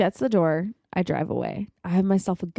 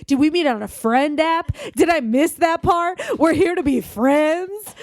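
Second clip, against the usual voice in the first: high, loud mock outrage; incredulous, escalating tirade at a bad date; outraged inner rant; 7 semitones higher, 5 dB louder than usual. Silently ranting at Matt over being just friends, high outrage. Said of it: "in my brain, I look at him".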